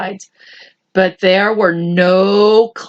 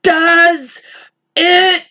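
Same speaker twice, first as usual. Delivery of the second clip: cried; 7 semitones higher, much slower than usual. Acted. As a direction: build to a high cry. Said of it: "cried the earthworm".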